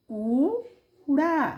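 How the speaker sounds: कूड़ा slowly, with a long held oo; long vowel. Shown as a demonstration. Teacher sounds out a long u word, long vowel. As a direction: draw out the oo of कूड़ा, then add the second syllable. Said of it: "ये बड़ा है तो बड़ा उच्चारण".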